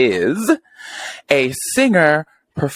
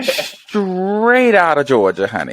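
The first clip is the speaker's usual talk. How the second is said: sassy drawl; 8 semitones higher, slower than usual. Acted. Hyping guest's Southern roots.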